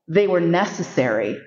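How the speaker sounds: usual speaking voice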